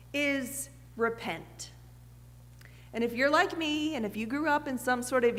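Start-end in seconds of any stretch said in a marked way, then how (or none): 0.1-1.7 "repent" slow, lower and softer; weighted word. Real, not acted. The preacher naming the key word, slow and hushed. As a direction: let "repent" land slowly and quietly. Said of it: "the word that Jesus uses".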